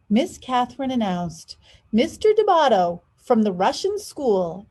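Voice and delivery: lively storybook read, big pitch swings; animated picture book narration; reading to children. A narrator's reading. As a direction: read aloud to kids with expression. Reads picture book aloud.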